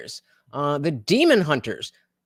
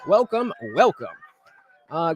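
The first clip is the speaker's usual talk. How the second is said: ordinary talk, nothing marked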